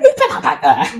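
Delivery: high indignant question through a laugh; mock indignant. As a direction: play innocent and outraged. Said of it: "I went".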